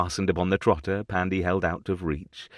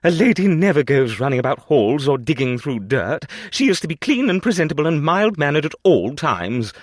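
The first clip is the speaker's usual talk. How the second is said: louder, a lightly voiced prim lecture; stern grandmother; 8 semitones higher, 8 dB louder than usual. A narrator's reading. Grandmother lectures the little girl, loud prim scolding. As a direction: scold like a strict old lady.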